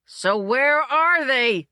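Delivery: slow, high, loud yell; a director yelling for the missing rider. A narrator's reading. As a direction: drag out an exasperated shout. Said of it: "yelled the director".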